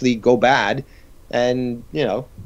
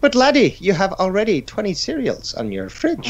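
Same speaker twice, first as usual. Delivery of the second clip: high, scolding Irish leprechaun; Irish accent; 6 semitones higher than usual. Pretend. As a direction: tease him like a leprechaun. Leprechaun scolding about cereal.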